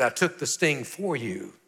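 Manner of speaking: put-on tender, soft reassurance to a frightened boy; calm the child down gently; gentle father. Father reassuring his terrified son, softly and tenderly. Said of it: "He said".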